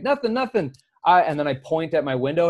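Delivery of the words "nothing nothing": panicked denial. Retold or imitated. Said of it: "And I'm like".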